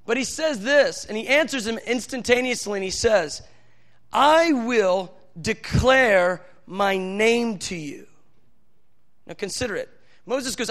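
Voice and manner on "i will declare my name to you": solemn slow reply. Retold or imitated. Preacher voicing God's solemn reply.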